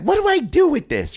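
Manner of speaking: high, baffled mom voice; mock clueless mom holding a gun; confused mom. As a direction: squeak it out like a lost mom. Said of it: "most moms are just like".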